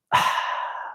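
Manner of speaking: real, weary exhale at a rude remark; exasperated sigh. Real, not acted. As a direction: let out a put-upon sigh. Called bald by a commenter.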